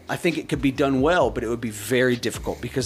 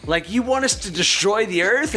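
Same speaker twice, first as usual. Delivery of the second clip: higher, incredulous outcry; disbelieving enemies; 8 semitones higher than usual. Retold or imitated. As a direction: toss it up in shocked disbelief. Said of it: "all of his enemies are like".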